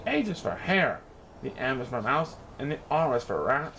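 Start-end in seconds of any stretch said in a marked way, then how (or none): none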